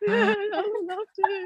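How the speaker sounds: very high, drawn-out squeal of a yes; overwhelmed acceptance. Retold or imitated. Applicant accepting the program offer, overwhelmed. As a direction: re-enact accepting, slow and choked up with joy. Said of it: "so overwhelmed".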